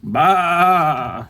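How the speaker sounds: long, high sheep baa; sheep bleat. Acted. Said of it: "says the sheep".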